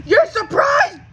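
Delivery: high, loud laughing yell of disbelief; incredulous laughing shout. Real, not acted. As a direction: burst out laughing at the absurdity.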